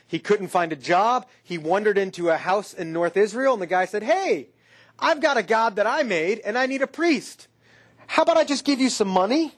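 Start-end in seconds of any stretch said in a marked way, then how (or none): 4.0-9.5 higher-pitched, casual sales pitch; lightly acted man making a job offer; eager householder's pitch. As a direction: pitch it brightly as a casual proposal. Acting householder hiring a priest.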